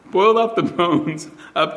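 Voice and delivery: real laughter breaking through the telling; cracking up. Real, not acted. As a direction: try to get through the story while cracking up. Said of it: "for cracking up there".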